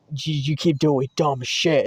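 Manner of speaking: slow, lightly voiced scolding cop; a hint of a blunt, lecturing officer. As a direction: imitate a cop's drawn-out telling-off. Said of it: "that's how he said it".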